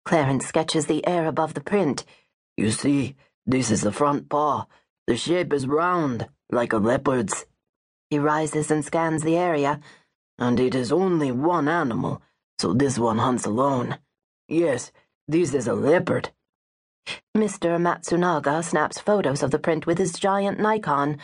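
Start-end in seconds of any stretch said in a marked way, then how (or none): 2.6-7.4 lower, steady voice of a patient guide; calm African tracker. A narrator's reading. Tracker explaining the paw print to tourists, calm and low. 10.4-16.3 lower, steady voice of a patient guide; calm African tracker. A narrator's reading. Tracker explaining the paw print to tourists, calm and low.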